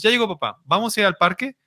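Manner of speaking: put-on high, eager little boy voice; imitating son. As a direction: chirp it like a toddler waiting for dad. Son voiced greeting returning dad.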